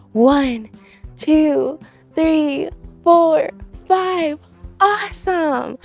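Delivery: loud, upbeat workout-leader call; loud cheerleading. Real, not acted. Host sends kids back inside for story time, loud upbeat call.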